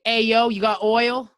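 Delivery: loud, high, drawn-out caricature; imitated American. Americans asking Canada for oil, loud mock American. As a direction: call out slowly as a pushy American. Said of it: "it's like the Americans".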